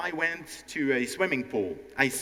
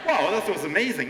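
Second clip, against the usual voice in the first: laughing, fast praise; 6 semitones higher, 4 dB louder, faster than usual. Real, not acted. Laughing praise after the demo.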